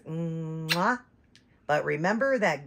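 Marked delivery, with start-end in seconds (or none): 0.1-1.0 blown kiss. Acted. Blowing a kiss.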